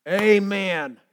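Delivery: loud, high proclaimed Amen; booming amen. Retold or imitated. The Father answering Jesus, a loud amen. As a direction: declare it with authority. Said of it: "the Father said".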